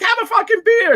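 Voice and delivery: very high insistent mock voice. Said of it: "And he's like".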